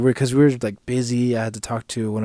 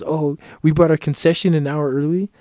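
loud, higher mock complaint; raised, aggrieved whine of complaint; mad customers; 6 semitones higher, 7 dB louder than usual. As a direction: imitate indignant customers griping. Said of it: "just getting mad because".

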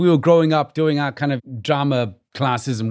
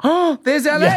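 mock awe; 11 semitones higher, 4 dB louder than usual. Retelling awe at landing in LA.

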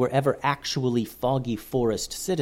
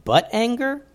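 puzzled; 12 semitones higher than usual. A narrator's reading. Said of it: "asked Joseph".

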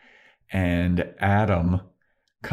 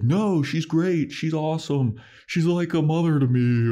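mock Riley, pitched higher and gushing; a higher-pitched imitation of Riley insisting Walsh is great; 9 semitones higher than usual. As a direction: gush like a sheltered fan of Walsh. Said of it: "And Riley's like".

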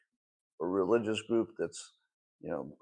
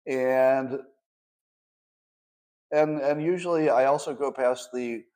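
ordinary delivery

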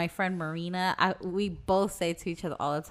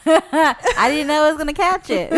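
higher, louder, laughing through it; 7 semitones higher, 10 dB louder than usual. Real, not acted. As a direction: confess it mid-laugh. Laughing confession.

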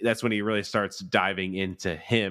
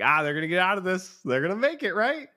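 much higher, fast and hopeful; mock hopeful; 11 semitones higher than usual. Viewer mocking their own false hope, high and fast. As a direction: chirp it like a naive optimist.

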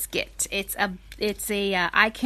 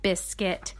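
plain reading voice

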